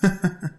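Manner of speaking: short string of chuckles; light playful laugh; teasing laugh. Acted. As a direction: laugh as you slip away.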